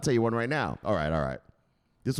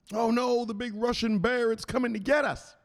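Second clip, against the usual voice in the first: mock scared cry; 12 semitones higher than usual. Scared Ukrainian leaders voiced.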